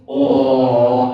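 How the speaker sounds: deep, loud howler monkey hoot; imitating the howler monkeys while climbing the ladder, deep loud hoot; howler monkey call. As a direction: boom it out like a jungle howler. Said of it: "howler monkeys just".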